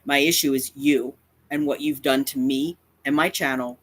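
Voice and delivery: ordinary talk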